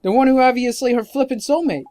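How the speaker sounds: high, emphatic fairy voice, indignant; exasperated sidekick scolding a blockhead; excitable fairy sidekick. Acted.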